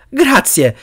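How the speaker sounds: high-pitched grateful exclamation. A narrator's reading. Harry thanks the creature that let him pass, exclaiming. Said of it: "esclamò Harry".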